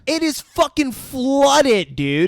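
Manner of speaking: loud, high, stretched-out disbelief; drawn out in loud disbelief; incredulous. Real, not acted. Exasperated at the film.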